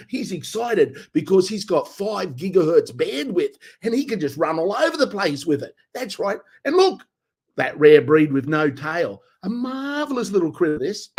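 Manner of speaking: excited Australian presenter, pitch jumping up; Australian nature guy. Acted. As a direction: gush over the rare critter in an Australian accent. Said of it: "the Australian nature guy".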